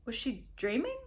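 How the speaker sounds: plain reading voice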